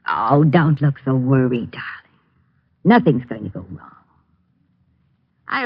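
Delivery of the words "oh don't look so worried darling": normal talking voice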